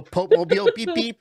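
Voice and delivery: high, bright playful beep beep and waving. Pretend. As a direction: tease it like a toy car honking. Joking about Pope-mobile beeping.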